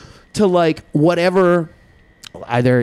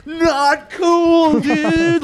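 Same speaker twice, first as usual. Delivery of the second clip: high, drawn-out surfer voice, much higher; mock surfer-dude voice; 13 semitones higher, much slower than usual. Mock stoner dude telling off pool kids, high and drawn. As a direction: play a laid-back stoner dude. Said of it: "people are like".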